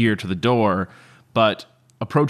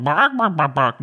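high barking squawk, a bad fake chicken; hammed-up fake chicken noise; bad chicken impression; 6 semitones higher than usual. Shown as a demonstration. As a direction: squawk it like someone faking a chicken badly. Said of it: "somebody was actually going".

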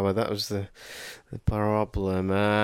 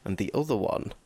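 usual speaking voice

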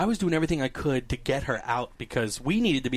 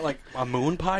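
normal talking voice

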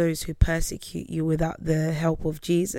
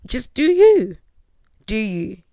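dismissive; 4 semitones higher, 6 dB louder than usual. Acted. Dismissive aside, high.

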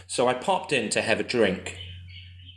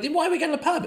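high incredulous question; puzzled new guy, pitched way up; baffled newcomer; much faster than usual. Retold or imitated. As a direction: squeak it out in disbelief. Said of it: "I'm like".

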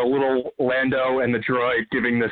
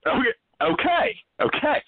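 mock high okay; 14 semitones higher than usual. Caller mock-accepting being in Australia.

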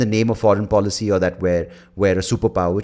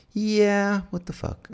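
exasperated; 10 semitones higher, 4 dB quieter, much slower than usual. Retold or imitated. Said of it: "but sometimes it's like".

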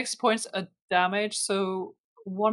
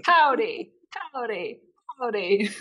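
hammed-up cowboy accent, higher at first; 4 semitones higher than usual. Game master practising a lost cowboy accent. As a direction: try out a cowboy howdy. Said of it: "I can't do a fucking cowboy accent".

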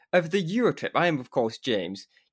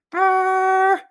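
high loud buzzing horn blare; party horn sound; 8 dB louder than usual. Retold or imitated. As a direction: blow a party horn with the lips. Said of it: "I'm talking about a".

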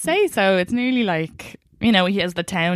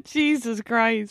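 laughing; 9 semitones higher, much slower than usual. Real, not acted. Laughing after spitting drink.

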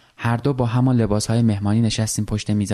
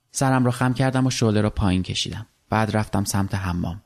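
ordinary delivery, nothing marked

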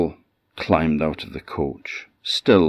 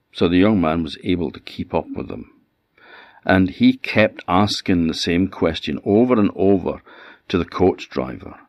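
ordinary delivery

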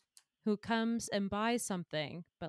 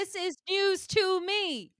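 high, loud, slow protest; stunned, drawn-out insistence; indignant astonishment; 9 semitones higher, 6 dB louder than usual. Acted. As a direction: draw it out high and loud, astounded. Protests at learning the plot.

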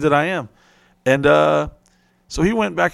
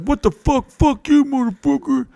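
much higher shrieky yell; mock angry woman; 12 semitones higher than usual. The woman cursing them out, much higher. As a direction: squawk it like an angry lady.

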